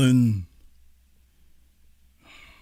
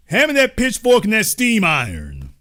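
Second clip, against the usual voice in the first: mock barber call, bigger; 7 semitones higher than usual. Mock barber, repeated louder.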